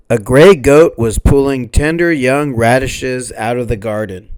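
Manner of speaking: ordinary delivery, nothing marked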